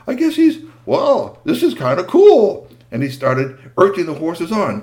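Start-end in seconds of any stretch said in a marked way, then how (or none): 0.0-2.7 put-on delighted boy. Boy thrilled in the sky.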